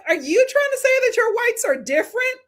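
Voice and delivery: high-pitched incredulous challenge; heated. Retold or imitated. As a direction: reenact a heated, disbelieving retort. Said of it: "I was so heated".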